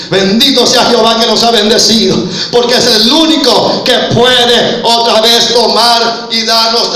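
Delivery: loud, emphatic proclamation; full-force preaching. Acted. Preacher proclaiming Naomi's blessing and the redeemer, full-force preaching.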